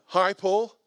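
slightly aggressive. Retold or imitated. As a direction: greet him with a slight edge. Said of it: "like, slightly aggressive".

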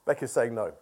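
ordinary talk, nothing marked

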